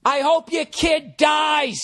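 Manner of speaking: high, drawn-out mock shout; long-stretched, high-pitched taunt; shouted heckle. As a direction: yell it across the stadium, imitating the buddy. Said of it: "He chimes in".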